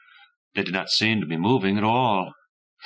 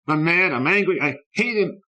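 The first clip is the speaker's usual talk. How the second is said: higher, louder, rising anger; building anger; 10 semitones higher, 4 dB louder than usual. A narrator's reading. Boy repeating his anger to himself, now higher and louder. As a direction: work yourself up into a high angry cry. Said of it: "he told himself over and over".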